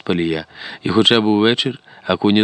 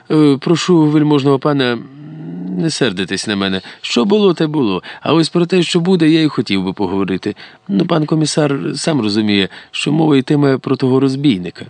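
higher-pitched, placating schemer; ingratiating informer; 7 semitones higher than usual. A narrator's reading. Mnohohrishny begging the lord's patience, ingratiating. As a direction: soothe an offended lord, mostly pitched up.

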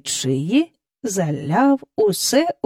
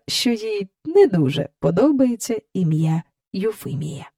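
ordinary delivery

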